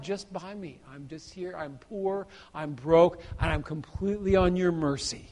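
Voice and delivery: barely voiced surrender; soft plea from a broke sinner; humble plea. Humble sinner coming to God.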